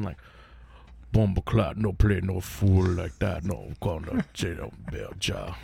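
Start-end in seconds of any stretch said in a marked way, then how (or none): 1.1-5.5 put-on slow, low, deep gutter Jamaican accent. Showing podcast hosts a deep gutter Jamaican accent, slow and low. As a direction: drag it down into a heavy Jamaican growl. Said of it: "real gutter Jamaican".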